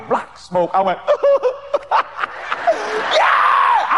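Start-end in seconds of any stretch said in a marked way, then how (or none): 1.0-3.1 a high burst of laughter, half shouted. Real, not acted. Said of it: "I shouted". 3.1-4.0 high, loud cheering yell; joyful shout. Retold or imitated. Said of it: "I shouted".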